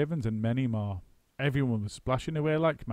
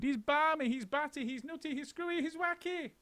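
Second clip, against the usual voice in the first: very high, fading teasing chant; cheeky monkeys piling on; high sing-song teasing; 4 dB quieter than usual. A narrator's reading. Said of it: "they cried".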